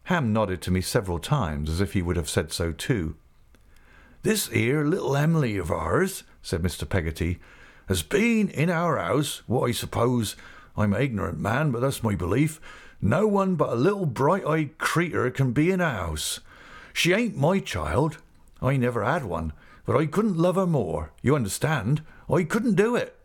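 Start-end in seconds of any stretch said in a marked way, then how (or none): 4.2-6.2 lifted rustic voice, proud; Mister Peggotty, fond. Acted. Uncle beginning his niece's story, fond rustic voice. 7.9-23.2 rustic voice rising high on the heartfelt points; Mister Peggotty, earnest. Acted. Uncle explaining his love for Em'ly, earnest and emphatic.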